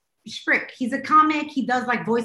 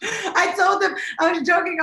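laughing; 6 semitones higher than usual. Real, not acted. Laughing after teasing.

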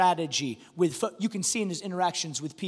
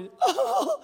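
high imitated sobbing wail; put-on child's bawling; mock crying. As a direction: wail like a kid crying while he plays. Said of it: "He's crying as he's playing".

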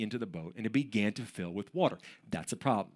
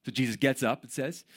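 normal talking voice

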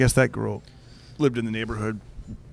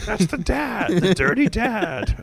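higher, slow and disgusted; pretend accusing lady; 8 semitones higher, slower than usual. Teller voices the imagined lady, higher and slow. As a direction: drag out dirty dad with mock scorn.

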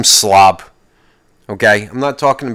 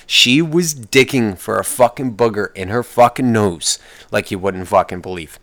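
ordinary talk, nothing marked

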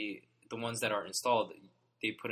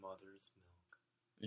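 soft, almost whispered; breathy whisper; 3 semitones lower, 15 dB quieter than usual. Pretend. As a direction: whisper it dreamily. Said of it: "dreamy repeat of the phrase".

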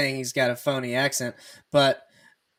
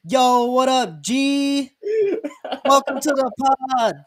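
higher, louder hammed-up hype voice; mock hype greeting; 10 semitones higher, 5 dB louder, slower than usual. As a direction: play an over-the-top podcast host. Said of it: "I'm like".

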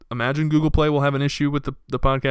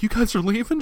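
upset coworker; 10 semitones higher than usual. Retold or imitated. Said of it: "She was, like, all upset".

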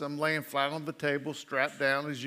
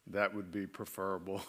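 dry doctor reply; 7 semitones lower, 9 dB quieter than usual. Retold or imitated. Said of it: "And he said".

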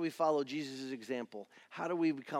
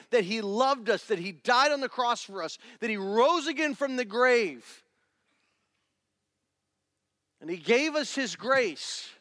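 loud, high, driving sermon; full-force preaching; 7 semitones higher, 6 dB louder than usual. Acted. Preacher rousing the congregation about grace. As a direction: preach it with fire.

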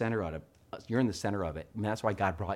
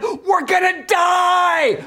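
high, drawn-out terrified mock scream; slow, full-volume panicked yell on a plunging ride; 15 dB louder, much slower than usual. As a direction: scream it at the top of your lungs. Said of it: "screaming at the top of our lungs".

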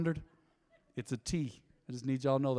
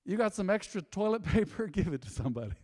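ordinary delivery

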